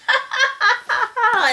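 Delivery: high-pitched acted belly laugh. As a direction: laugh merrily as mouse and lion. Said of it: "they're laughing".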